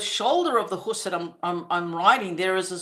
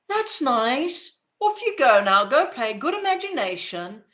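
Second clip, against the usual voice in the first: higher, indulgent sing-out of a busy parent; dismissive mom; 4 semitones higher than usual. Retold or imitated. Mom brushing off child's story, indulgently dismissive. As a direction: humour the child and send them off. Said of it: "that was my mom".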